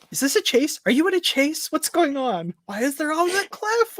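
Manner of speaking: high, rapid-fire incredulous questions; acted-out banter. Host voicing the teaser's back-and-forth, high incredulous banter.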